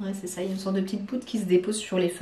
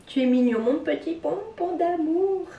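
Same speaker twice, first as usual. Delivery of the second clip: much higher, slow doting coo; doting pet talk; 9 semitones higher than usual. Acted. Telling her cat he is cute, slow high doting coo. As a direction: melt over the cat, drawn out and high.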